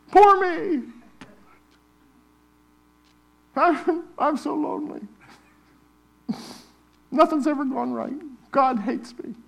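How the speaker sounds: hammed-up pity party on the phone; mock self-pity. Preacher acting a whiny call to Matt, mock wailing. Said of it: "I'm gonna call Matt".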